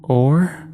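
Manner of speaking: OR drawn upward, left hanging; hesitant. A narrator's reading. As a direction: let "Or" rise and hang, unsure. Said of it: "she hesitated".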